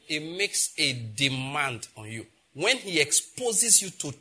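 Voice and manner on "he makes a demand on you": slow, weighty repeat; slow emphatic repeat. Shown as a demonstration. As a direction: drive each word home slowly. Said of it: "What did I say?".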